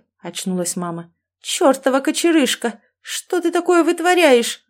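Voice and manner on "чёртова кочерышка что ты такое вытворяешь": high-pitched indignant outburst; angry scolding. A narrator's reading. Mother scolds son for his antics, angry scolding. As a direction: scold him, voice climbing high.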